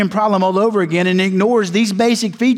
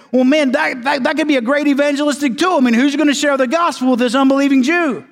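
higher, eager voice of a keen churchgoer; imagined objector; 6 semitones higher than usual. Retold or imitated. Preacher voicing an eager churchgoer's objection, higher pitched. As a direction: voice him a bit higher and pushier. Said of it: "somebody's gonna say".

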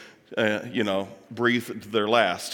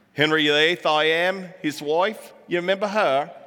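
higher, badly faked British accent; horrible British accent; 3 semitones higher than usual. Speaker jokingly prompting the audience about Marie Antoinette, horrible British accent. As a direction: put on a hammy, bad British accent. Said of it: "I've got a horrible British accent".

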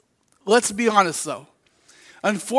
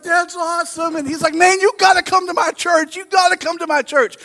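higher, eager excited stranger; 9 semitones higher, 3 dB louder than usual. Retold or imitated. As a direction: press the invite with eager excitement. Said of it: "he was like".

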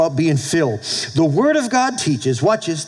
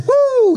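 high, drawn-out "woo"; party whoop; 5 dB louder than usual. Retold or imitated. Preacher mimicking high-energy personalities, excited whoop.

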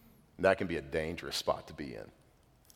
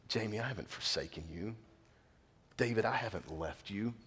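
gentle reassuring voice of God; 7 dB quieter than usual. Acted. God speaking tenderly to individuals.

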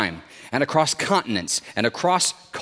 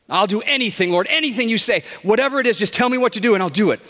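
high, urgent pleading; desperate eagerness; 8 semitones higher, 3 dB louder, faster than usual. A narrator's reading. Stranded man vows to obey the Lord, high urgent plea. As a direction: promise anything in a frantic rush.

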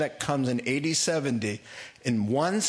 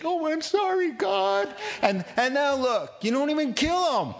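much higher, put-on whining voice of a sulking prophet; whiny mock Jonah; 14 semitones higher than usual. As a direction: squeak it out like a petulant Jonah mocking repentance. Mocking Jonah's complaint.

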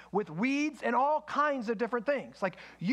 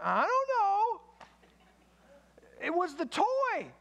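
put-on higher, whiny child insisting it wasn't them; child's excuse; 6 semitones higher than usual. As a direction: play the kid dodging blame. Child answering parent in story.

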